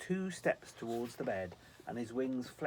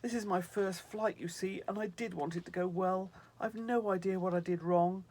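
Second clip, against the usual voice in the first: high, loud worried angel voice; fretting angel; 8 semitones higher, 7 dB louder than usual. Acted. Snow angel fretting to Sylvie about his mistake. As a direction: panic over a botched first flight.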